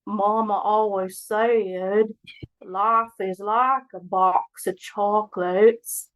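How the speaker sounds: slow, stretched Southern drawl; Forrest Gump accent. Pretend. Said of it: "let me hear your Forrest Gump impression".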